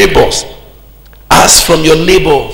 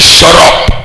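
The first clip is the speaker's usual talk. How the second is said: forceful, louder cut-off; brusque interruption; 5 dB louder than usual. Retold or imitated. Preacher voicing God silencing a man's excuses, brusque.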